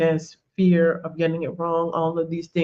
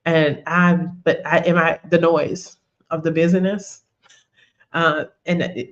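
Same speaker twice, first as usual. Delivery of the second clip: ordinary talk, nothing marked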